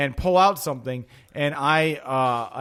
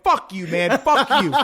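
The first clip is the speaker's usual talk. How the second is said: laughing fuck you; 5 semitones higher, 3 dB louder than usual. Retold or imitated. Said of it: "I'm like".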